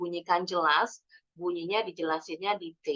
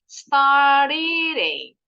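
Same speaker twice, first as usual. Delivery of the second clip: "Start eating" slow and louder, T flapped into "eating"; slow emphatic linking; 5 semitones higher, 8 dB louder, much slower than usual. Shown as a demonstration. Teacher drills the linked T slowly and loudly. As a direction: stretch it out and link the T across. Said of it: "Huruf T-nya nih".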